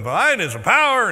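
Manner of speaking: loud, laughing blurt; blurting alone. Retold or imitated. Worshipper blurting the doxology alone, loud and laughing. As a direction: belt it out then realize you're alone. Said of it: "and you're going".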